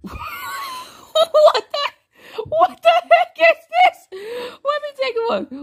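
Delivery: high startled yelling; shouting in shock. Real, not acted. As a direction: yell it in disbelief. Startled by an explosion in game.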